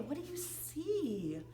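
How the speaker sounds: usual speaking voice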